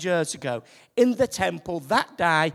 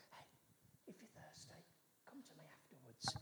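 much softer, as if to one person on the side; private aside; 3 semitones lower, 31 dB quieter, faster than usual. Retold or imitated. Jesus pictured whispering to one person, hushed. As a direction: murmur it privately to one listener. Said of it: "didn't just go up to one person".

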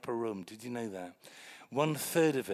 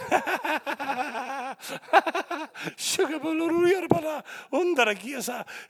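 high, bursting laughter; hearty laugh; 13 semitones higher, 5 dB louder than usual. Real, not acted. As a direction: laugh out at the memory. Preacher laughs at his story.

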